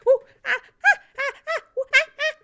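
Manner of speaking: high, loud gleeful monkey hoots; happy monkey hoots. Acted. Monkey celebrating at the castle, gleeful high hoots. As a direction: bounce through it like a dancing monkey. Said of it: "starts doing these very happy little half jump".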